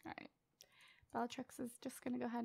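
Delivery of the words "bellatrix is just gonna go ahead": whisper. Acted. Whispering narration while playing.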